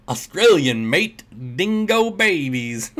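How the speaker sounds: hammed-up, failed Australian accent; bad Australian accent. Streamer who can't do accents having a go, bad Australian accent. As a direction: try an Australian accent and draw out the last words. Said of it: "do an Australian accent so bad".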